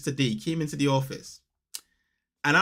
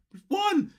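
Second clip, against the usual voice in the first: much higher, squealy cheer; mock kid cheer. Father imagining his son cheering the win. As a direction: cheer like a happy child.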